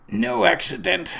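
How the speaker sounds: strained, throaty words from a dying man; guttural whisper. Acted. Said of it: "Albrecht said in a guttural whisper".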